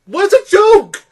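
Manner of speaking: high, loud exasperated outburst. Real, not acted. Reviewer fed up with the film, exasperated. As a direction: burst out in disbelief, high and loud.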